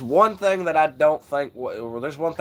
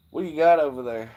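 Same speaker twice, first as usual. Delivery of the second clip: ordinary talk, nothing marked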